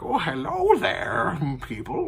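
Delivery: put-on high, slow sing-song greeting from a strange old man; drawn-out, overly friendly old eccentric; a lightly voiced old stranger cooing hello; odd old man. An odd older man greets them.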